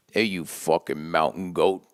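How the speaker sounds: low, slow mock greeting to an old friend; slow low teasing hello to a bandmate; Pete's gruff greeting. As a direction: drop low and drag it out like a buddy teasing. Voicing Pete's late-night phone greeting.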